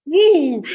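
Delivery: high, loud "woo"; loud whoop. Real, not acted. Speaker breaking into talk with a loud, high whoop. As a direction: let out a big high whoop.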